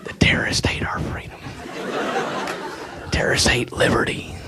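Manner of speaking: soft unvoiced whisper. Comic imitating the president whispering his slogan. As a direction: hush it like a secret. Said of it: "he's always, like, whispering that".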